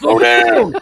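high, loud mock shout at passing cars; hammed-up holler, breaking into laughter; playful old-man yell. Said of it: "Old man yells at cars".

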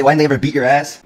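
a viewer loudly demanding an answer, mock heckle. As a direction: shout it like a heckler. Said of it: "some of y'all will say".

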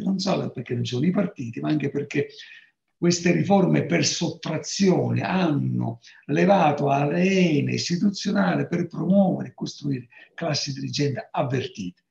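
normal talking voice

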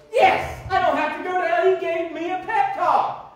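loud, high, building joy; excited preaching. Real, not acted. Preacher exulting to his church that he is saved.

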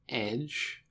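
soft murmur, well under normal volume. A narrator's reading. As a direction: murmur it to yourself while reading. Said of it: "he murmured".

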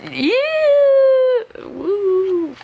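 loud high whoop; excited cheer. Real, not acted. Cheering about prom day.

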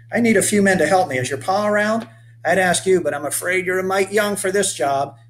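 rugged 1830s settler hailing a stranger; weathered old boatman. Acted. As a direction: call out to the boy like a sun-burned old sailor. Asks for the boy's father.

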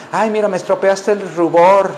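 lightly mocked fussy woman; put-on fussy lady's complaint; delicate lady. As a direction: complain like a delicate lady about her makeup. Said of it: "las mujeres son muy delicadas".

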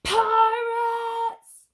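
high, loud yell of pirates; yelled alarm. Acted. Tom yelling to warn the village, loud and high. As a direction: shout the alarm at the top of your voice. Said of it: "yelled Tom".

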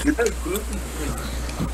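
slow, high imitated Indian accent. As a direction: copy his Indian accent, slowly. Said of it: "I wish I could still do that voice".

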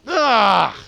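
high, loud exasperated ugh; loud frustrated groan. Real, not acted. Frustrated outburst.

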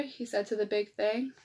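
lively picture-book read-aloud; reading to children. A narrator's reading. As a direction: read it like story time for little kids.

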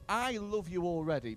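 normal talking voice

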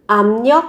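압력 said as "amnyeok", the b turned to m and the r to n; 압력 with b nasalized to m and r to n; sound change. Shown as a demonstration. Teacher showing the sound change in 압력. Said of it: "phát âm là biến âm là".

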